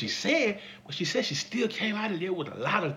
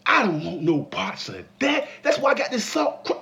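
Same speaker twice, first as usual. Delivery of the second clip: ordinary talk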